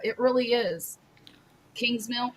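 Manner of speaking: ordinary talk, nothing marked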